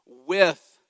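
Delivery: with punched hard and loud; stress on with. Shown as a demonstration. Preacher hammering the key word, with said loud. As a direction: lean all your weight on with. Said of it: "that's the key word".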